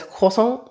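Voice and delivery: put-on croissant said the kid's way, soft and rising, the r dropped to kʰɔsɑ. Mom imitating her daughter saying croissant. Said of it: "you have to say it like that".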